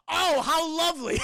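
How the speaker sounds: high, loud sarcastic gush; hammed-up ironic enthusiasm; mock delight. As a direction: exclaim with overdone false rapture. Mock delight at grim island reveal.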